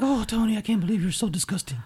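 very high put-on aunt voice; disgusted old aunt. Co-host mimics Tony's aunt scolding him, disgusted old aunt voice. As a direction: scold Tony in a mock falsetto. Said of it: "Your old aunt".

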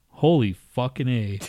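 ordinary talk

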